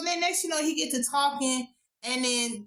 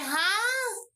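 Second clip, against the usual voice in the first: high, drawn-out baffled huh; mock bafflement; 9 semitones higher than usual. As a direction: imitate a puzzled reaction, pitched way up. Acts out wife's baffled reaction.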